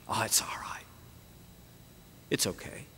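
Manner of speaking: soft whispered reassurance; whispered forgiveness; gentle whisper. Acted. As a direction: hush him, all is forgiven. Master forgiving the kneeling servant.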